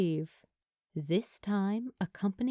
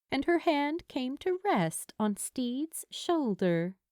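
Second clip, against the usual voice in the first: ordinary delivery, nothing marked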